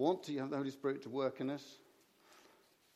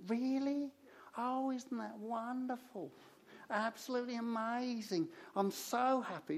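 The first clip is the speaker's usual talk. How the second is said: higher-pitched, lightly voiced Cockney lady; a hint of a humble Cockney churchgoer, pitched up; little Cockney lady; 10 semitones higher, slower than usual. Cockney lady answers Jane after the service, lightly voiced, higher. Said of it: "little Cockney lady".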